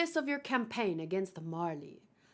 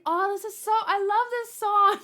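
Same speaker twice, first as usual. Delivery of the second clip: gushing fan; 9 semitones higher, 8 dB louder than usual. Retold or imitated. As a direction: swoon over the tune you love. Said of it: "Now I think".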